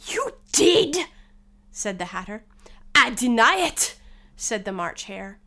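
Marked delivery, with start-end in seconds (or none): 0.1-1.0 high, loud accusation; contradicting Hatter. Acted. Hatter contradicts March Hare. 3.0-4.0 higher, loud, emphatic denial; March Hare's denial. Acted. March Hare denies accusation.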